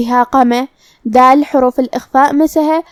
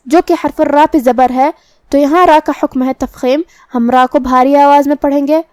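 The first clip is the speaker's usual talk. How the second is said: ordinary talk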